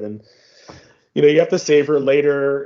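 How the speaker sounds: ordinary talk, nothing marked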